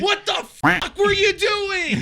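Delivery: high, loud, drawn-out yell; hammed-up outraged shout at a teammate; angry comms outburst. Gamer on comms to a teammate, exasperated shouting.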